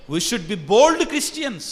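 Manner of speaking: bold stretched out word by word; Pope's line repeated slowly; slow emphatic quote. Retold or imitated. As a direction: spell out bold Christians slowly. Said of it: "అని చెప్తాడు చక్కగా".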